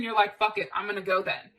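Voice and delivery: normal talking voice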